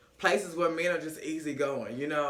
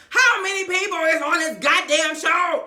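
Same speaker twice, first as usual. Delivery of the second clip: loud, high-pitched outburst; fed-up hollering at full volume; exasperated shout; 11 semitones higher, 9 dB louder than usual. Real, not acted. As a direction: yell it in comic disbelief. Exasperated outburst at cast size.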